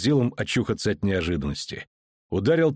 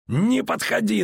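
yelling thug; 11 semitones higher, slower than usual. A narrator's reading. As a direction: scream the threat to keep him back. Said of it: "заорал он".

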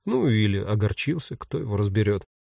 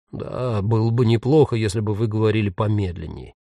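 slightly lowered, hesitant ghost voice; lightly voiced ghost, agreeing; 4 semitones lower than usual. A narrator's reading. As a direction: agree tentatively, keeping it subdued. Ghost's voice in dialogue.

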